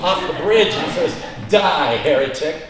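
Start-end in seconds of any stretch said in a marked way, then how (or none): 1.5-2.6 mock fury. Punchline, pushing man off.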